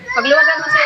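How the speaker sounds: cry-tinged alarm; urgent calling out. Real, not acted. Baby crying, comforting it.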